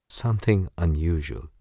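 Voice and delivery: plain reading voice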